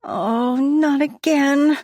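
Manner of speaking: slow, high "not again"; dismayed groan. A narrator's reading. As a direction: dread another bout. Morning sickness dismay.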